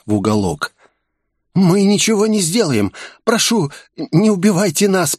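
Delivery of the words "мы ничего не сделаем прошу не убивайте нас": high, fearful pleading from a bald office worker; frightened mumbling plea. A narrator's reading. Bald worker begging the gunman to spare the staff, high and scared. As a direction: beg for mercy in a raised, scared voice. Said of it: "промямлил лысый мужичок".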